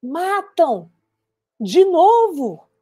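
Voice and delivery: high, slow, stretched-out protest; anguished dismay. Acted. Survivor lamenting that people kill again, anguished outburst. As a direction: cry it out word by word in disbelief.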